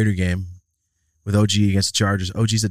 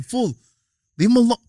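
high call to leave it; 11 semitones higher than usual. Retold or imitated. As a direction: call out across the lot. Calling out to friend.

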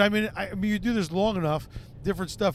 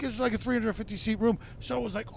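usual speaking voice

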